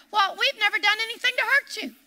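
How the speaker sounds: mock-innocent denial, rising in pitch; friends' denial. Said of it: "they told her".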